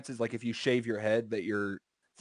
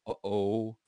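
uh-oh; 4 semitones lower, 4 dB quieter than usual. Retold or imitated. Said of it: "and I was like".

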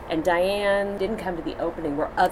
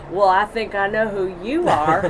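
put-on Houston accent; 3 semitones higher, 6 dB louder than usual. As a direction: imitate a Houston Texan, higher and louder. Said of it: "the awesomeness of her Houston accent".

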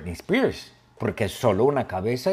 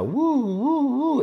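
high wailing siren sound; mock ambulance siren; siren imitation; 14 semitones higher than usual.

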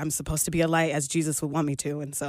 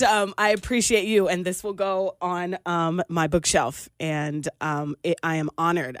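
ordinary talk

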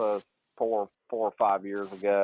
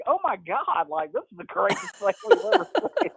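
high, laughing disbelief; amazed laughing. Retold or imitated. Mates watching a player's club tantrum, high laughing awe. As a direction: gush through laughter like it's the best show ever.

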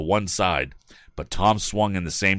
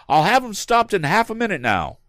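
calling out; 14 semitones higher, 4 dB louder than usual. A narrator's reading. Tom calling to the woman.

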